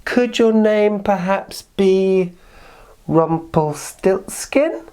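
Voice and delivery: high, very slow teasing question; sly guess. Acted. Queen slyly guessing the little man's name, slow.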